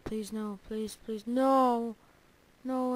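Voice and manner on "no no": sharp loud cry of dismay; real shout. Real, not acted. Gamer shouting as the cow nears the edge. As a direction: yell no as it goes wrong.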